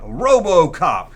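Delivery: ordinary delivery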